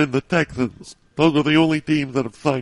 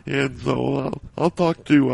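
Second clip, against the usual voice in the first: usual speaking voice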